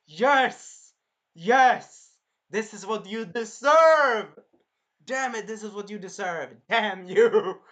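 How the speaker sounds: loud, high, gloating cheer; vengeful yelling. Retold or imitated. Viewer watching the villain burn, loud vengeful yell. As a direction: shout it in fierce triumph, stretching key words.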